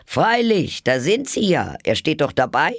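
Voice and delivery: high, gloating king; triumphant. A narrator's reading. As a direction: crow it like he's won. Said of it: "sagte der König triumphierend".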